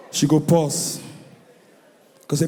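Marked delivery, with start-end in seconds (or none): none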